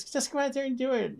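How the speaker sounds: high, lightly put-on German accent; a hint of a German mentor's accent. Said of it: "very heavy German accent".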